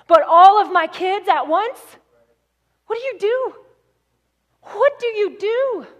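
high, loud, bewildered questions; anguished disbelief. Acted. Preacher voicing a father who lost all his children, anguished and incredulous. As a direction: voice leaps up, pleading for an answer.

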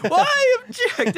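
high, loud, insistent cry; dramatic yell. Retold or imitated. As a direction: shout it over the objection, hammed up. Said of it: "he just yells".

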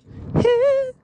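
very high falsetto "hee!"; high squeal. Acted. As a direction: let out a short piercing pop-star squeal. Prince impression at the door.

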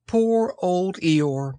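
high, slow pitying voice; sympathetic. A narrator's reading. Christopher Robin to cold Eeyore, sympathetic. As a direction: draw it out with pity. Boy pities the donkey.

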